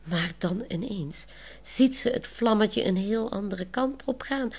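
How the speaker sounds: ordinary delivery, nothing marked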